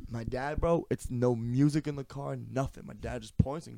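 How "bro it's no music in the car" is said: ordinary delivery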